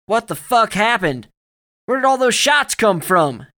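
loud, high-pitched demanding yell; shouting. A narrator's reading. Soldier demanding answers after gunfire, shouting. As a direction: shout the questions at full force. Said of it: "Dugan was shouting".

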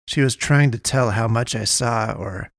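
plain reading voice

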